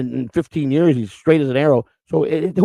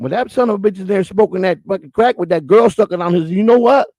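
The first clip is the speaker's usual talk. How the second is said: imitated Southern mother griping, pitched up; southern old lady; 5 semitones higher than usual. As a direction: grumble as an old Southern lady, high-voiced. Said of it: "a nice old lady answered the door with a southern accent".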